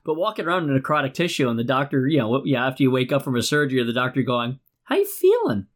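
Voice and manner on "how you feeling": doctor's voice. Retold or imitated. Voices the surgeon after surgery.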